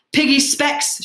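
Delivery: high, loud yell; urgent shout. A narrator's reading. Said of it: "shouted Ralph".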